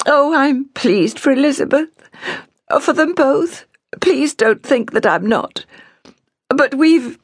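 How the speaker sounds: very high, tearful protest. A narrator's reading. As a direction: plead tearfully, pitched well up. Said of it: "Clara said tearfully".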